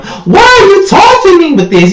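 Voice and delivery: high-pitched wailing protest; hammed-up squeal of outrage; mock outraged cry. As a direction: cry it out very high, theatrically wounded. Acting out an upset quote.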